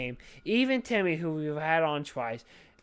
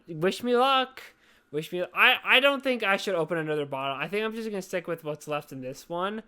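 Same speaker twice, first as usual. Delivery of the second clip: ordinary talk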